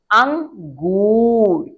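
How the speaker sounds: अंगूर very slow, nasal ng held long; drawn-out demo. Shown as a demonstration. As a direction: stretch अंगूर for the children to hear the ng. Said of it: "मिलकर बने".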